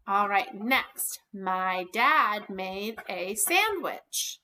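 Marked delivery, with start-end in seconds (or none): 1.3-4.4 very slow, even example sentence; slow dictation. Shown as a demonstration. Teacher reading an example sentence slowly to the class. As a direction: read it slowly so kids can follow. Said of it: "What would I need?".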